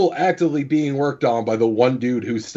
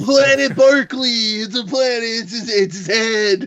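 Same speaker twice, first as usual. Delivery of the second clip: high, over-excited exclaiming; excited outburst; 8 semitones higher than usual. Pretend. Telling friends about the game's absurd planet, in a high excited cry.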